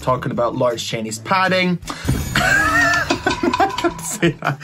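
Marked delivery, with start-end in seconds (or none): 1.8-4.0 a burst of high, airy laughter. Real, not acted. Big laugh at own joke.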